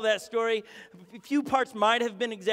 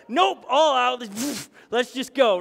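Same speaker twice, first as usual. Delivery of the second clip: revved-up go; 3 semitones higher, 6 dB louder, slower than usual. Retold or imitated. Acting his jet ski attitude.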